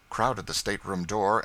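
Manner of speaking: ordinary delivery, nothing marked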